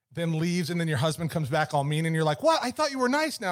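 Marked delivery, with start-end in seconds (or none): 2.4-3.5 confused wife. Retold or imitated. Wife voiced in story.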